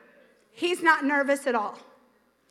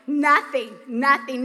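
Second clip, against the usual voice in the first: ordinary delivery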